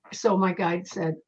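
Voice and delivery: usual speaking voice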